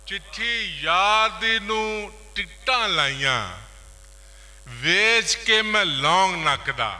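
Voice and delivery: projected folk-verse recital in short phrases; poetry recitation. Acted. Poet presenting a Punjabi boli, declaimed.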